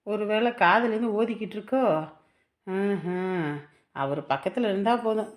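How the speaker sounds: low, muttered complaint; grumbling aside. Acted. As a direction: mutter to yourself about the mother-in-law. Daughter-in-law grumbles aside about mother-in-law.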